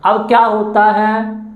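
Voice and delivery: ordinary delivery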